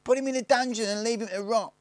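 very high, loud threat; high-voiced gang boy. A narrator's reading. William Tell suggests a punishment, high shouted threat.

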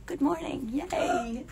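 soft unvoiced good morning; hushed morning hello; whispered hello. Real, not acted. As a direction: whisper a playful greeting. Whispered greeting on livestream.

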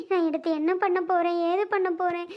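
ordinary talk, nothing marked

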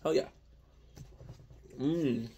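ordinary talk

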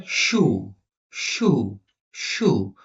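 শু repeated with a clear sh sound; pronunciation demo. Shown as a demonstration. Teacher showing how shoe sounds, pronunciation demo of শু. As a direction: model শু slowly, sh at the start, word by word. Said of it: "আবার শুটা কি বলে?".

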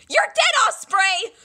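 high, loud yell of a threat; furious shout. Acted. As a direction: scream it at the liar. Angry threat after a lie.

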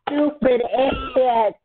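angry insult. Real, not acted. Insulting the one who killed them.

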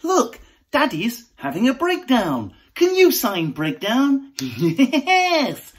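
put-on bright, sing-song children's presenter; kids' TV voice. Dad narrating his own breakdown in a kids' TV host voice. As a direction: announce it like a kids' show host.

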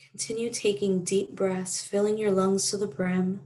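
calm meditation voice with long pauses; guided meditation. Real, not acted. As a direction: gently guide listeners through breathing, unhurried. Leads a gratitude meditation.

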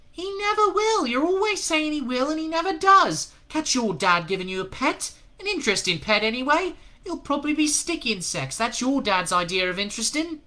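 put-on very high, loud boyish jeering; mock your friend like a know-all kid; scornful kid. A narrator's reading. Boy voice teasing friend.

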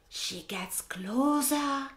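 ordinary delivery

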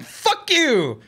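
high, scornful mock defiance; defiant fuck you. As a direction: spit it back at the Mexican army. Texans defying Mexican army, acted.

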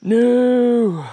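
loud, high drawn-out cry of dismay; dismayed shout. Real, not acted. Angler spotting his scuffed line, dismayed shout. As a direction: wail the word in protest.

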